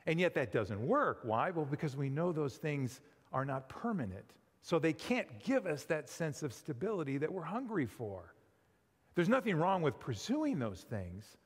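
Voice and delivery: ordinary delivery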